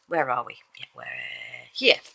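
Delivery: usual speaking voice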